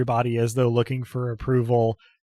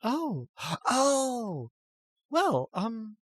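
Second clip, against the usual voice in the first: much higher, gasping startled oh; flustered gasp; 11 semitones higher, 6 dB quieter, much slower than usual. Acted. Apatia flustered by the offer, high gasping. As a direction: caught off guard by an offer.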